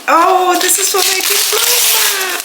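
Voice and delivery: high, delighted coo over a gift; touched squeal. Real, not acted. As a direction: let the voice soar, truly touched.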